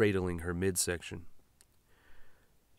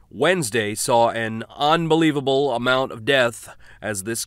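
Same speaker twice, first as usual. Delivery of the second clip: louder, formal speech voice; presidential address; 3 semitones higher, 7 dB louder than usual. Acted. President speaking to the nation on radio, formal address. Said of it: "what the president had to say".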